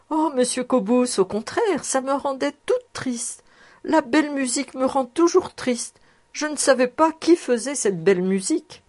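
put-on young girl Suzelle. A narrator's reading. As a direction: voice her as a sad, sincere young girl. Suzelle voiced by narrator.

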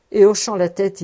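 plain reading voice

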